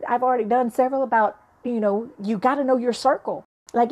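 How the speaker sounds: ordinary talk, nothing marked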